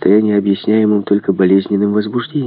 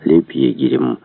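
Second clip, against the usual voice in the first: usual speaking voice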